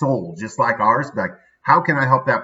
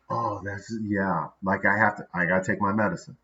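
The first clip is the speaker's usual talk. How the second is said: muttered self-calming; 4 semitones lower, 7 dB quieter than usual. Retold or imitated. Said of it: "I'm like".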